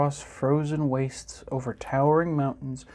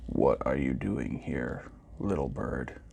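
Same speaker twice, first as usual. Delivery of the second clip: much lower, softer robot voice, a broken heart in it; deep, quiet, forlorn robot; sad robot; 13 semitones lower, 6 dB quieter than usual. A narrator's reading. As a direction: speak low and slow like a lonely old machine. Robot speaks in the story.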